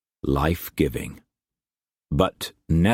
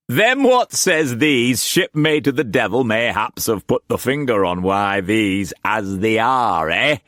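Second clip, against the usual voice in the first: put-on much higher, hammy old sailor voice; old sea-dog Brock; 5 semitones higher than usual. Brock welcoming Struan to his table, hammed-up old sailor. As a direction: boom it out like a rough old trader. He laughed.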